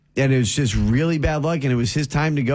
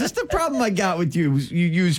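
higher-pitched, exasperated mock tirade; mock scolding; 7 semitones higher than usual. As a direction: playfully lay into your co-host, pitched up. Mock tirade at Northeastern co-host.

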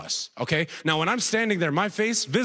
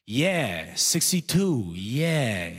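put-on drawn-out echo of a clueless old man; senile older guy; 3 semitones lower, much slower than usual. Older guy ad-libs the first guy, senile and drawn out. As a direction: chime in vaguely, stretching each word. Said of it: "Then the second older guy goes".

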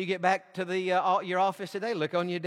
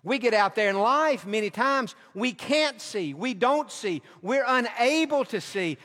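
ordinary talk

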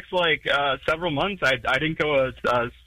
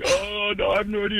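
higher, innocent playing-dumb voice; imitating his younger self; 8 semitones higher than usual. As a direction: act like you have no clue. Retold self answering cop.

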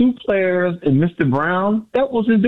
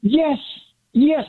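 excited; 9 semitones higher than usual. Real, not acted. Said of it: "the excitement that you got in your voice".

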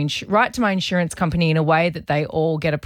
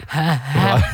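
robotic laugh; 4 semitones lower, 3 dB louder, slower than usual. Retold or imitated. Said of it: "it just goes".

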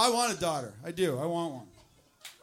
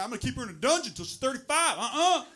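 loud, raised Southern overprotective father; Southern dad; 6 semitones higher, 8 dB louder than usual. Acted. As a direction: bluster like a hammed-up Southern dad. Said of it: "you know that guy".

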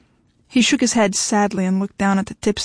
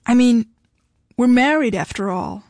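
lightly sulky, wounded plea; pouting; 3 semitones higher than usual. A narrator's reading. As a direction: say it with a pout.

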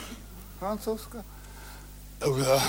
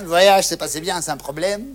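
usual speaking voice

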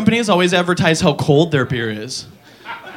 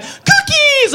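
shrill high squeal of delight; excited squeal. Acted. Comic's Doughboy squealing at cookies from the oven. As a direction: squeal it way up high like a tiny thrilled creature. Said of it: "and he'll be like".

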